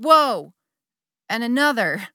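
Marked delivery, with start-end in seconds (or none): 0.0-0.5 excited whoa. Retold or imitated. Repeated ecstatic fall.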